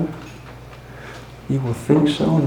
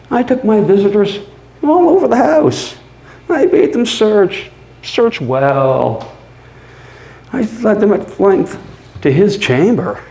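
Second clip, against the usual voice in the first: much higher and louder, gleeful; manic triumph; 7 semitones higher, 4 dB louder than usual. Acted. Narrator showing police the house, manic and triumphant. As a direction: crow it out, giddy with confidence.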